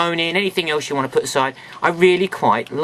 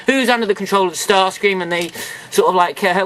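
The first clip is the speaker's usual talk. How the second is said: normal talking voice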